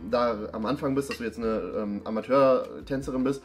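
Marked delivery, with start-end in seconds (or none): none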